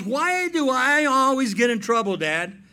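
put-on high, loud, aggrieved teenage complaint; a hammed-up daughter protesting; complaining daughter. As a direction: whine it like a wronged teen. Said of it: "she goes".

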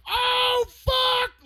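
high, loud disbelieving yell; stunned shout. Retold or imitated. Seeing the winning pass, loud stunned shout. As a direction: explode at the throw.

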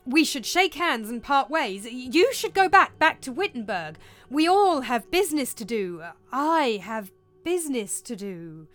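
wild, emphatic outburst; agitated. Acted. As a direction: press them to leave, overwrought. Hamlet babbles after seeing ghost.

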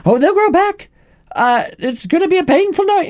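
very high put-on matron voice; squeaky-high mock nurse; Madam Pomfrey. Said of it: "Madam Pomfrey comes in and says".

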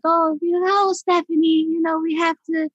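high, light [name] voice. Retold or imitated. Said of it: "I did the light voice for Michael".

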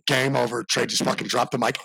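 ordinary talk, nothing marked

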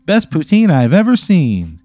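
high, drawn-out jingle-like tagline; hammed-up pitch to camera, slow and swooping; commercial slogan. Mountie and lady telling the camera their tagline, hammed-up. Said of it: "they both turn to the camera".

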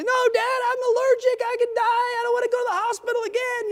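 put-on very high, frightened child's wail; panicked boy. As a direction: squeal like a scared kid begging. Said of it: "he's screaming".

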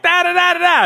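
high rapid engine buzz; racing noise. Shown as a demonstration. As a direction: mimic a revving motorbike, high. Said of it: "In racing, it's like".

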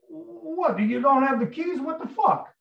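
high, indignant questioning; acting out exasperated disbelief, pitch way up; incredulous irritation. Tony asked to move a car, high irritated disbelief. Acts Tony's incredulous reply.

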